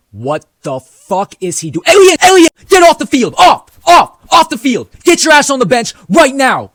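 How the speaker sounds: high, loud furious shouting; coach yelling. Retold or imitated. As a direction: scream him off the field like an angry coach. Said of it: "I heard the coach yelling at me".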